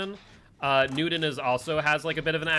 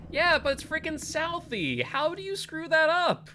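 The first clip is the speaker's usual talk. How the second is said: much higher pitched, incredulous protest; exasperated disbelief; 13 semitones higher than usual. Real, not acted. Streamer to Frankie over a mistaken accent, high-pitched exasperated disbelief.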